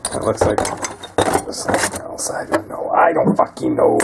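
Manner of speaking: breathy whisper, muttering to himself. Real, not acted. Mechanic unsure of a part, whispering to himself in frustration. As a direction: hush it under your breath, annoyed.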